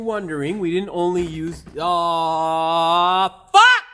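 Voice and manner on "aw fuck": pained shout. Real, not acted. Mechanic hurting himself mid-explanation, pained shout. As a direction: blurt the curse out at full force.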